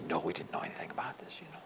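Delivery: breathy whispered reply in church; hushed pew whisper. Retold or imitated. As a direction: whisper it so the pastor won't hear. Whispered reply during church service.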